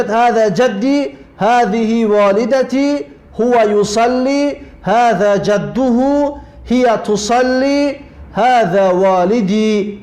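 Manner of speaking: each short phrase read out slowly and carefully; slow Arabic reading. Shown as a demonstration. Teacher to pupils, reading Arabic examples slowly.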